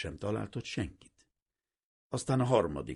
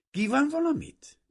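"valamit" said louder and higher, as if to a deaf man; louder repeat; 9 dB louder than usual. A narrator's reading. Girl repeating for a man she thinks is deaf, louder. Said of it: "ismételte meg a leány hangosabban".

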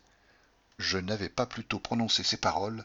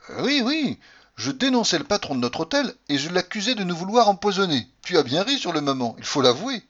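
higher, louder, a touch embarrassed; Hector flustered; 7 semitones higher, 5 dB louder than usual. A narrator's reading. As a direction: hurry to justify yourself. Hector excuses himself.

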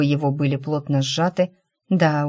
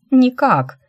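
sharp retort; 9 semitones higher, 6 dB louder than usual. Acted.

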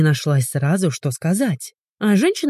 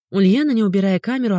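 ordinary delivery

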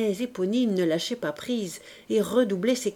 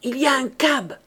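urgent warning in a raised voice, short of a shout; a touch hoarse and higher than usual; hoarse, without shouting; 5 semitones higher, 4 dB louder than usual. A narrator's reading. Girl stopping a burglar at the gate, hoarse warning. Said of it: "une voix enrouée lui dit sans crier".

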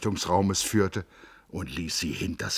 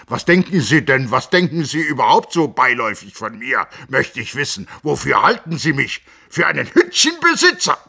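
higher, louder, working up into a rage; a doctor bristling at an insult; rising temper; 9 semitones higher, 11 dB louder than usual. A narrator's reading. The Hofrat flares up at Hans Castorp, growing angry. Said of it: "Es war Jähzorn".

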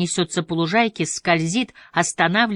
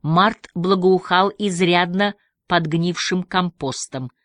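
ordinary delivery

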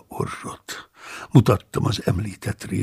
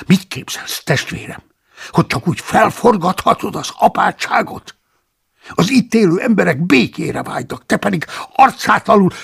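higher, louder indignant abbot; angry scolding; 10 semitones higher, 6 dB louder than usual. A narrator's reading. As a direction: rebuke the monk sharply. Abbot angrily scolding the monk. (felháborodott, hangos, magasabb apáti szidás)

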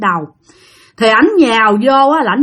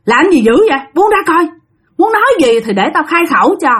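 higher, commanding exorcist's voice; raised voice of a ritual master; stern shaman; 4 semitones higher than usual. Retold or imitated. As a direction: order the spirit to let go. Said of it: "ông thầy mới nói rằng".

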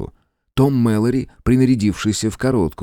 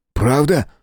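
high, startled query; alarmed, pitch jumping up; alarmed question; 7 semitones higher than usual. A narrator's reading. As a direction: ask with sudden worry. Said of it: "встревожился Том".

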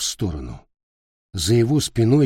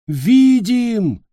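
crowd answering; 12 semitones higher, 4 dB louder than usual. A narrator's reading. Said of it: "откликнулась толпа".

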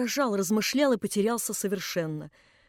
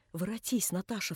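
ordinary delivery

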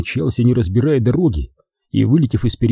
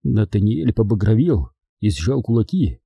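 usual speaking voice

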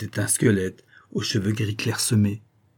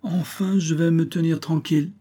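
high, relieved exclamation; exclaiming Toto; 7 semitones higher than usual. Acted. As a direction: cry out with relief at last. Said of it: "s'écria Toto".

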